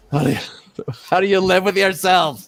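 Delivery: high, loud mock accusation; hammed-up scandalised outburst; mock outrage. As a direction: wail it in pretend indignation. Joining the mock-outraged bit.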